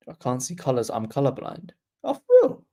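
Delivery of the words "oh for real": much higher, louder sudden question; genuinely taken aback; surprised. Real, not acted. Streamer surprised by friend's colourblindness, high surprised.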